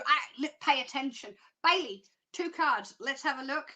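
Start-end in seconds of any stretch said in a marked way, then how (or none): none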